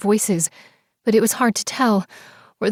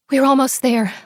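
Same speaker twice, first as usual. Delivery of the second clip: breathing hard; 5 semitones higher, 4 dB louder than usual. Acted. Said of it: "I breathed hard".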